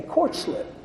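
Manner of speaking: soft, half-voiced aside to himself; muttering under his breath about the court slip; hushed inner thought. Newcomer thinking to himself, hushed and wary.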